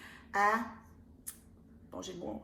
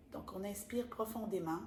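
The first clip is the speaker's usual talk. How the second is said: méditation guidée; 7 dB quieter than usual. Acted. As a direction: lead them softly into calm. Guides a card-reading meditation. (voix de méditation guidée, lente et douce)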